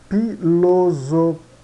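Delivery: "Filosofia" slowly broken into fi-lo-zo, pause, fia; syllable by syllable. Shown as a demonstration. Teacher showing the PH example to learners, slowly syllabified. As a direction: spell out "Filosofia" in slow separate syllables. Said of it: "se pronuncia F".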